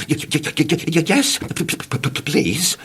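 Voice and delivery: trembling stutter; nervous man says yes please; quivering stammer. Acted. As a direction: stutter it while shaking. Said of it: "quivered and shook and said".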